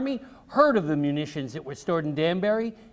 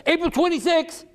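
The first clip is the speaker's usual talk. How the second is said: high eager interruption; child jumping in with the date; interrupting child; 13 semitones higher, 4 dB louder than usual. A narrator's reading. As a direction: cut in high like a know-it-all boy. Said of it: "Richard interrupted".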